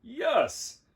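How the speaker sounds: high, loud burst of glee; bouncing excitement. A narrator's reading. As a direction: burst out like a giant puppy. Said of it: "almost bounced with excitement".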